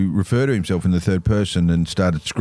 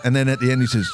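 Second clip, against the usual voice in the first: ordinary delivery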